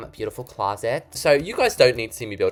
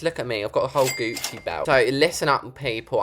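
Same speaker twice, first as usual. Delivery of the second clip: ordinary talk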